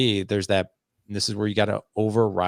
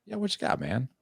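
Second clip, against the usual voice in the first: high, soft casual invitation; easy invite; 4 semitones higher, 6 dB quieter than usual. Retold or imitated. Dad casually inviting his teenage son to share. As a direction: make it a relaxed open door. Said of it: "Tell me more is like".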